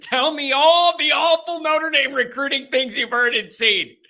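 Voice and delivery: high, loud hotline announcer; radio host show opener. Acted. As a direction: belt out the welcome like a radio host.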